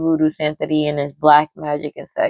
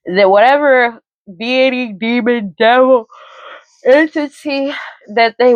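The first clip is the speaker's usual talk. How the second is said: real yawn stretching the words, higher pitch; talking through a yawn; 6 semitones higher than usual. Real, not acted. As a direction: let a yawn swallow the phrase as you speak. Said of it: "I don't know why I'm yawning".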